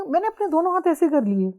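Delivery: ordinary talk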